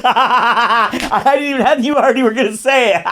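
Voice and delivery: high, loud words through laughter; laughing hard. Real, not acted. Co-host guessed the story, laughing. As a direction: crack up while talking. Cracks up at predicted story turn.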